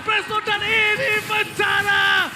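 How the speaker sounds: shouted game-winning hype call; fighting game announcer. Real, not acted. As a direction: roar the final teamfight.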